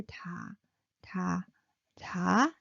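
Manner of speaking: low, soft "ta"; soft, low, separate "ta" syllables; low pitched, soft. Shown as a demonstration. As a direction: say "ta" gently on a low note. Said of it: "Low pitched and soft".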